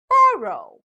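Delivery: "borrow" with BOR punched high and loud; "borrow" demonstrated with strong BOR, falling away; stress on first syllable. Shown as a demonstration. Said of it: "stress is given on the first syllable".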